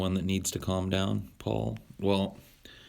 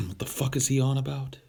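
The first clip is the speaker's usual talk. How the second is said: higher-pitched, baffled mock question; mock bafflement; 7 semitones higher, 3 dB louder than usual. Streamer voicing a reaction to his own rant, mock bafflement. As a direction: put on a higher, incredulous voice.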